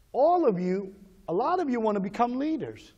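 usual speaking voice

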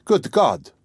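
excited cry. A narrator's reading. Lodger desperate for his letter, excited cry. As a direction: exclaim with a burst of excitement. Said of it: "cried Raskolnikov, greatly excited".

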